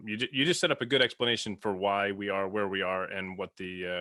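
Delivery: ordinary talk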